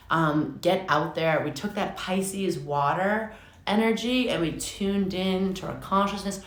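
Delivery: ordinary talk, nothing marked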